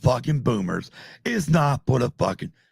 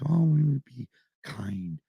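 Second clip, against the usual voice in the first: soft, hushed sugary goody-goody voice; mock kind voice; 4 semitones lower, 6 dB quieter than usual. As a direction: play the ever-kind nice guy, mockingly. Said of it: "[name] plays".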